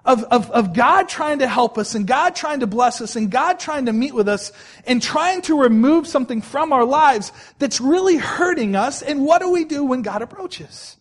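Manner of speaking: loud, driving sermon delivery; full-voiced pulpit preaching. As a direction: preach it out to the congregation. Preacher building to full force.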